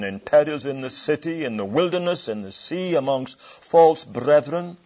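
ringing, rising preacher's recitation of the perils; scripture reading. Acted. Preacher reading Paul's perils to the congregation, declaiming. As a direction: declaim each peril like a sermon climax.

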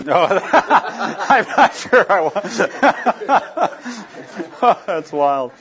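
words broken by real laughter; laughing. Real, not acted. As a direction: laugh your way through it, high and slow. Group laughs at the Dodge story.

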